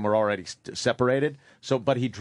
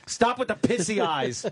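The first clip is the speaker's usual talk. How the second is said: a hint of a stern father, higher; 6 semitones higher than usual. Father scolding his son for crying, lightly voiced stern father. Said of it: "what he told me all the time".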